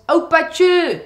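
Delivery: Hague dialect. Acted. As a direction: call out opaatje in a high, loud voice. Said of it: "he says".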